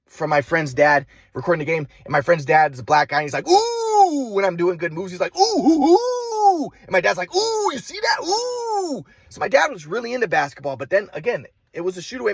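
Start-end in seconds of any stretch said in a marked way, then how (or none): 3.5-4.3 long high-pitched "ooh"; admiring whoop. Retold or imitated. Friend's dad watching good moves, high admiring whoop. 5.4-6.7 high, drawn-out run of "oohs"; admiring whoops. Retold or imitated. Friend's dad reacting to good moves, high excited hoots. 7.3-9.0 high-pitched whooping spectator imitation; excited dad. Dad cheering his son's move, high excited whooping.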